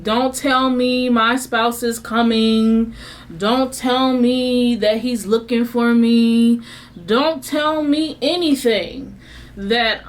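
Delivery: slow, stressed "don't tell me"; slow, firm refusal; emphatic don't. Retold or imitated. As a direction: lean hard on each "don't". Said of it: "angry retelling".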